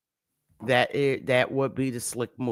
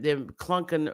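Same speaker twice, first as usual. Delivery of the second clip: ordinary delivery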